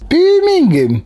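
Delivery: teasing echo. Real, not acted. Teasing echo of James's word.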